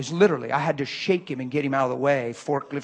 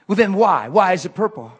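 loud, higher, challenging demand; angry demand; 5 semitones higher, 12 dB louder than usual. Retold or imitated. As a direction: demand an answer, furious. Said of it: "and he's mad".

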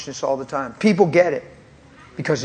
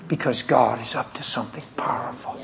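softer, breathy hushed confiding; whispered; 6 dB quieter than usual. Real, not acted. Pastor telling host about the young generation, hushed whisper. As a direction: lean in and whisper the secret. Solemn point to listener.

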